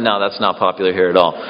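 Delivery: preacher voicing a man at the back, low and quick; imitating a heckler. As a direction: toss off a deadpan objection from the back. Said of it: "Got a guy in the back going".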